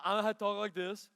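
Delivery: a lightly done, fast imitation of Bryce's talk; hint of a Bryce impression, fast; imitating Bryce. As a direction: tease the student by mimicking how he talks. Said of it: "this is how Bryce talks".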